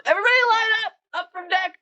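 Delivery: high, loud shouted order; yelling. Acted. Captain yelling for the crew to line up, loud. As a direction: bellow it across the deck. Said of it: "he's just yelling".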